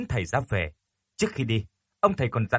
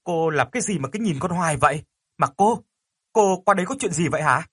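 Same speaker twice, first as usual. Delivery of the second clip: irritated yell; 6 semitones higher, 4 dB louder than usual. A narrator's reading. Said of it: "nên đã hét lên".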